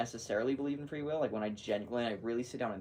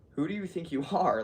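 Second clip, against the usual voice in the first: loud, high indignant challenge; 7 semitones higher, 6 dB louder than usual. Retold or imitated. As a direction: demand it, affronted and loud. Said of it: "I'm like".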